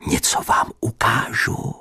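mysterious whisper; secretive whisper. Acted. Teacher promises the pupil a surprise, whispering mysteriously. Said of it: "řekl tajemně".

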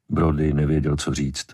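plain reading voice

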